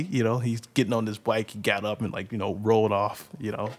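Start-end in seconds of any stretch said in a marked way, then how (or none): none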